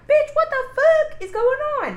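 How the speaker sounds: hammed-up shout of disbelief; playing Aubrey yelling in shock; mock outraged yell. Aubrey's outburst retold in story.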